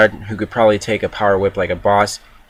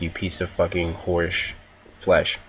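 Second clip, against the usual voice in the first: muttered curse; 3 semitones lower, 7 dB quieter, slower than usual. Retold or imitated. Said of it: "and I'm like".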